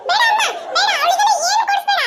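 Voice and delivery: put-on very high, insistent little-girl voice; high-pitched childish protest. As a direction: object frantically like a jealous sibling. Child protesting against sister getting paint.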